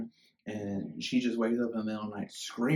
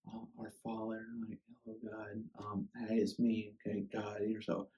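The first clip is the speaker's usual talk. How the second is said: hushed, much softer churchy prayer voice; mock pious prayer; 6 dB quieter than usual. Mocking the polite prayer he did not pray. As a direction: softly mimic a polite, formal prayer. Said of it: "It was not".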